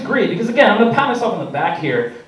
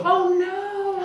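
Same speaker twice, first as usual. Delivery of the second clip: high, squeaky mock scream of a kid; mock kid panic; 14 semitones higher than usual. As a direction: squeal it like a scared kid on a game show. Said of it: "And he goes".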